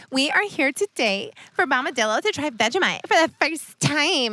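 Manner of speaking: high, hammed-up presenter announcement; over-the-top host voice; mock show intro. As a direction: announce the pretend first tasting grandly. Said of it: "Let's pretend that you've never had".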